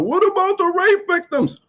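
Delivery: high, slow mocking whine of his critics. Vlogger mocks the people who always object, in a high slow whine. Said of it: "people always come with the".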